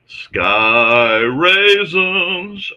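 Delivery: loud, high, very slow rising holler; playful elongated shout; drawn-out call. Real, not acted. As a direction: stretch SKY RAISINS out into a long, loud call. Calls out the cat phrase, drawn out.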